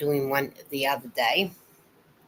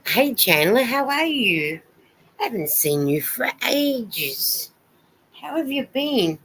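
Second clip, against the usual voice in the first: excited greeting; 6 semitones higher, 3 dB louder than usual. Real, not acted. Greeting a viewer in chat.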